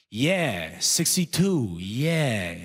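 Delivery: put-on drawn-out, clueless old sidekick; senile older guy. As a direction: echo it like a senile old man. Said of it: "the second older guy goes".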